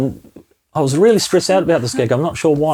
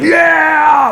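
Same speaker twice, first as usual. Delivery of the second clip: excited cheer; 13 semitones higher, 4 dB louder than usual. Retold or imitated. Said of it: "everyone was like".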